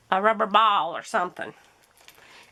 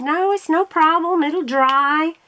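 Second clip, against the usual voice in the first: higher-pitched, polite brush-off; imitated easygoing reassurance; 6 semitones higher, 4 dB louder, slower than usual. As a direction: imitate a nice man waving off an apology. Retold soaked man reassuring dad.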